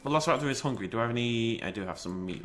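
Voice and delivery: ordinary delivery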